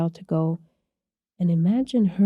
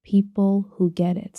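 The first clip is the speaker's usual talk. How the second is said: slow emphasis; slower than usual. Real, not acted. Repeated slow emphatic line.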